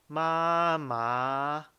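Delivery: 妈妈 loud and drawn out; first tone. Shown as a demonstration. Teacher drilling the practiced syllable, 妈妈 long and loud. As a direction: hold 妈妈 long and loud, fairly level. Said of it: "第一声是咪".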